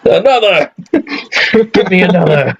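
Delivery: demanding shout. Pretend. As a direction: bang the table and call for another. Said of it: "throw our crust on the ground and say".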